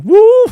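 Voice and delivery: very high, loud mock whoop; imitated shriek of alarm; startled whoop. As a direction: whoop as if jolted out of your body. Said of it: "my energy body was like".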